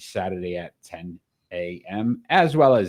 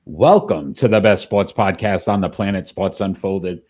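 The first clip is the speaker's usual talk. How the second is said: radio announcer voice; 8 dB louder than usual. Acted. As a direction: open the show like a booming sports radio host. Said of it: "Doing our radio voice".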